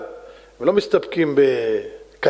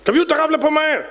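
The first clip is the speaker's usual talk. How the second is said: king's command; 11 semitones higher than usual. Acted. King orders the rabbi brought.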